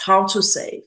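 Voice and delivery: ordinary talk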